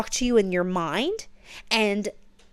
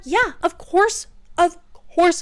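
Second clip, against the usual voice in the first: excited; 8 semitones higher, 4 dB louder, slower than usual. Real, not acted. Reader's emphatic agreement.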